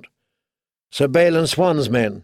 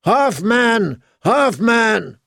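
high chanted cheer from the troops; high-pitched rallying call, falling at each end; ragged battle cry; 8 semitones higher, much slower than usual. A narrator's reading. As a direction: chant it like men rallying to their leader. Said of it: "heard them call out".

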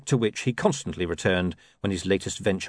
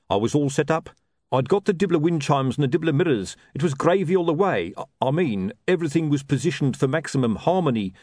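higher-pitched hawker bemoaning his luck; hard-done-by sausage seller; 6 semitones higher than usual. Acted. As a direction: play an unlucky street trader, pitched up.

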